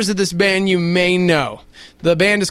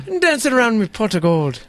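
put-on light Irish leprechaun accent; 2 semitones higher than usual. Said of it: "Say that in your Irish".

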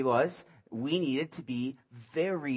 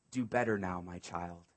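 lower, softer, tender reassurance; gentle master; 8 semitones lower, 5 dB quieter than usual. Acted. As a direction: speak it like a kind father forgiving. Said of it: "he smiled".

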